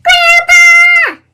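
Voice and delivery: high wailing cry for Grandpa; crying shout. A narrator's reading. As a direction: sob it out loud like a hurt little girl. Sister cries for grandpa.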